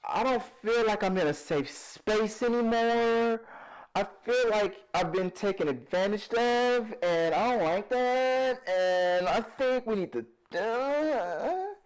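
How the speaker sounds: high, whiny pretend hurt; hammed-up near-crying plea; mock tearful. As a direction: complain like a wounded victim, drawing words out. Mock hurt, pretends to feel violated.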